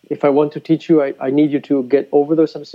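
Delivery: normal talking voice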